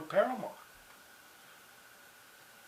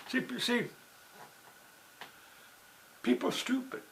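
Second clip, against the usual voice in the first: normal talking voice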